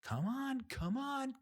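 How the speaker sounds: high-pitched hurry-up voice; mock urging. Volunteers hurrying a driver at ten miles per hour, mock urging. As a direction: egg the driver on in a high put-on voice. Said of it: "and they're like".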